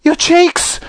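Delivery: very high-pitched yelp of alarm; horrified scream. Acted. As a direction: shriek it like a panicking mother. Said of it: "screamed Missus Beauregard".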